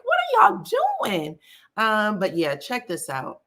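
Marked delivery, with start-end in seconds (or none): 0.0-1.4 retold shriek, much higher; high-pitched imitation of herself yelling at thieves; high mock shriek. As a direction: squeal "What are y'all doing?" up high in mock alarm. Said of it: "I was like".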